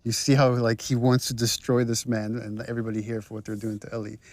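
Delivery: ordinary delivery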